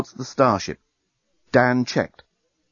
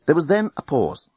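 ordinary delivery

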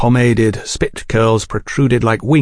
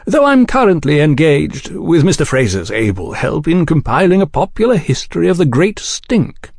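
high, lilting gentlemanly explanation; cordial gentleman journalist; 6 semitones higher than usual. A narrator's reading. Oliphant describing his book to the agents, cordial. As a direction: explain your work with smooth courtesy.

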